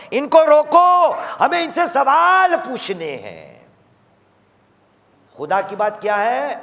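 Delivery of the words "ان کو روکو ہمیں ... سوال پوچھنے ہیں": high, loud order to halt them; commanding shout. Acted. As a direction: call out the divine command. Preacher voices God's command.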